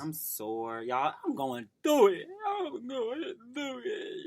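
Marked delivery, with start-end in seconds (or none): 2.5-4.2 high, slow mock wail; hammed-up crying wail. Co-host moaning to the podcast audience, high mock-crying wail. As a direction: drag it out high like fake crying. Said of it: "Please, don't do that no more".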